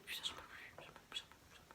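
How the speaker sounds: whispered, growing fainter, fed up; exasperated whisper. Real, not acted. Girl sharing her new year wish, exasperated fading whisper. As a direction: breathe it out like you've given up.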